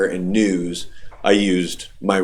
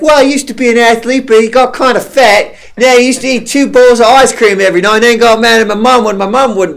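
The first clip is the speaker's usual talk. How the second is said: put-on high, louder little girl voice with an Australian accent; imitating a little girl; 12 semitones higher, 5 dB louder than usual. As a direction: mimic the chatty surfer kid and her accent. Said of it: "she's like".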